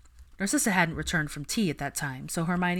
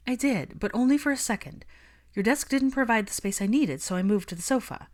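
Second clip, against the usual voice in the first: put-on a lightly higher, composed young woman; Hermione's voice; 6 semitones higher, 3 dB louder than usual. A narrator's reading. Hermione admitting to Malfoy she sat there, lightly voiced. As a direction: read Hermione, higher and steady.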